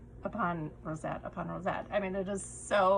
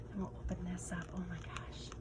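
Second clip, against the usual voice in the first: soft excited whisper; 8 dB quieter, faster than usual. Real, not acted. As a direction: whisper as you open it. Opening pouch, whispered delight.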